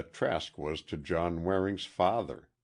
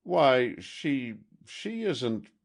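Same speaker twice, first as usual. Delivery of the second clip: flustered; 8 semitones higher, 4 dB louder, much slower than usual. A narrator's reading.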